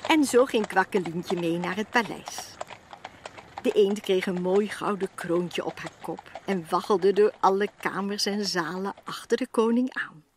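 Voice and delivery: ordinary delivery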